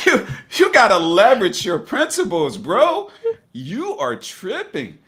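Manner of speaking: loud, high-pitched telling-off of yourself; hammed-up reality check to a bro; mock self-scolding. Jokingly scolds himself in acted quote.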